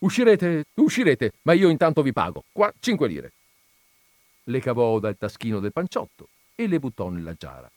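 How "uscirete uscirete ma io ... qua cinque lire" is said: higher, loud, hurried reassurance; hasty, insistent; impatient placating. A narrator's reading. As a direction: brush off his protest and press on. Owner brushing off the shouting man.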